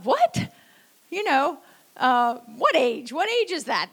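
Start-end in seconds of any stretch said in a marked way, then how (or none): 0.0-0.5 high, loud rising "what" of disbelief; startled disbelief. Retold or imitated. Patient reacting to being told about failing eyesight, startled. Startled reply to eye doctor's remark. 2.6-3.9 mildly indignant disbelief, pitch swooping up and down; incredulous questioning. Retold or imitated. Patient retelling reply to remark on aging, incredulous.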